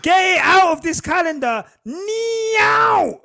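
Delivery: exasperated shout. Real, not acted. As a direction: yell it like you want it gone now. Ranting about Monaco GP.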